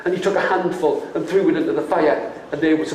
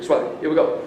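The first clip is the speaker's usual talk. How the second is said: ordinary delivery, nothing marked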